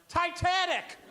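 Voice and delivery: high-pitched mock shout-out; eager wrong guess. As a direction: blurt it high, imitating an audience member. Audience member's bad guess acted.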